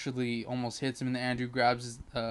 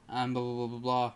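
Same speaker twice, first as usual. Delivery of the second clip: normal talking voice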